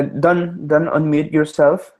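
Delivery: usual speaking voice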